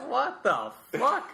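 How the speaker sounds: slow, drawn-out disbelief; incredulous. Acted. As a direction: stretch it out, shocked. Mock outrage at quote.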